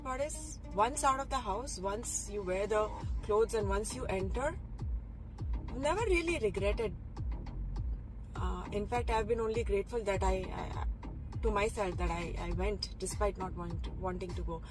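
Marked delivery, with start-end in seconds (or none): none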